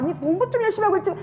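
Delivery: high, fast mum checking on studying; nagging mother. Acted. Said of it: "엄마가".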